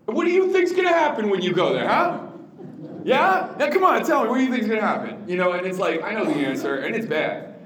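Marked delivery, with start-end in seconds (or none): 0.1-5.2 high, loud, prodding interrogation; suspicious parent. Shown as a demonstration. Parent to teen before a party, loud suspicious prodding. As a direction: needle the kid like you already know. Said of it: "asked in three different ways".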